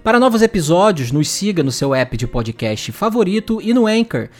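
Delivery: bright, upbeat announcer read; podcast outro read. Acted. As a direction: sell the outro like an ad. Reads outro call to action.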